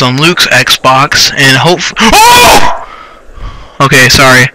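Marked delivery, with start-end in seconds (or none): 2.0-2.8 sudden high, drawn-out "oh!"; startled yell. Real, not acted. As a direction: cry out in fright, cutting yourself off. Startled by game mid-sentence.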